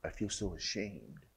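mock jealous parent. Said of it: "It doesn't make you go".

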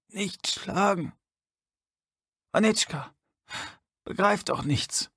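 put-on high, slow, strained old man voice; a frail old man pleading, forcing each word. A narrator's reading. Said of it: "presste der Alte hervor".

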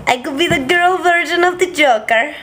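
high put-on villain voice; Joker voice. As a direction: play a cartoonish girl Joker.

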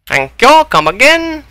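high, sing-song thank you, come again; bright fake customer-service sign-off; mock shopkeeper farewell. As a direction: chirp it like a cheery shop clerk. Mock shopkeeper farewell after kill.

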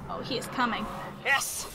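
ordinary talk

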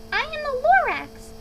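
high, loud creature proclamation; Lorax announcing himself. A narrator's reading. Lorax returning with gripes, proclaiming loudly. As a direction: announce yourself loudly, pitched right up. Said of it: "He coughed and he whiffed".

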